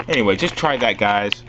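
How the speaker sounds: usual speaking voice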